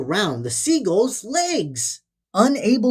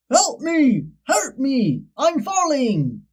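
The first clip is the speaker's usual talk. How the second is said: higher, urgent pleas for help; a storybook goose calling out, pitched up; goose shouting; 6 semitones higher, slower than usual. A narrator's reading. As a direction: cry out while falling. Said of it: "shouted the goose to the flock".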